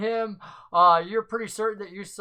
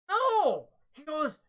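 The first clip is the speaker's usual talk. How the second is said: much higher, softer pleading voice; high, upset NPC; distressed protest; 11 semitones higher, 4 dB quieter than usual. Acted. Upset man protests his loss.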